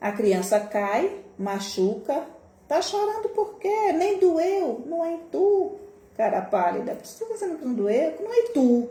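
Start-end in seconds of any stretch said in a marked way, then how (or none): 2.7-4.8 much higher, sing-song scolding; mocking dismissive adult. Mocking adult dismissing child's pain. 4.9-8.9 high, teasing comeback; mocking retort. Mocking retort, regional phrasing.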